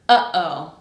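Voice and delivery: UH-OH with a throat catch between syllables; glottal stop. Shown as a demonstration. Teacher gives learners an example, glottal stop. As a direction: stop the air after UH, then release OH. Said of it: "Notice how you have that stop in the middle".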